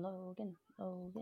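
very soft mock call; hushed imitation of repeated calling; imitated calling. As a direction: mimic someone calling a name, barely audible. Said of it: "He's going".

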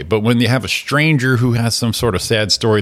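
usual speaking voice

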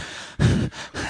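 winded exhale imitating breathlessness; mock out-of-breath huff; out of air. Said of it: "like, out of air".

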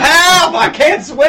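high, loud hammed-up panic; mock panicked screaming. Mimicking a contestant in shallow water, mock screaming. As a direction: wail it like a flailing swimmer, for laughs.

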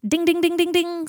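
high, loud, ringing dings; ding like a boxing bell on one high note; mock ringside bell; imitated bell. A narrator's reading.

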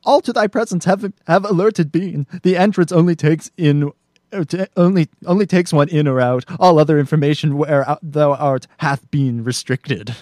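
a fretful robot delivering bad news; lightly done nervous droid; C-3PO reading. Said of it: "C three P O".

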